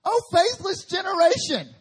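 preacher voicing Jesus' exasperated cry; exasperated Jesus. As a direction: wail it out in frustration.

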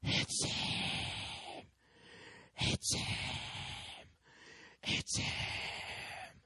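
an intense whisper standing in for a shout; hushed, overcome exclamation; whispered cry. Retold or imitated. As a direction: breathe the words out, overwhelmed. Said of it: "he starts to shout".

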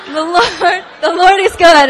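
real laughter breaking into the words; loud, laughing praise. Real, not acted. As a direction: talk through a laugh, high and loud. Laughing while telling her story.

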